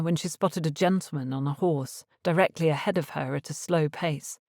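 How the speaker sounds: ordinary delivery, nothing marked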